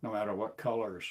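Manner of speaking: ordinary talk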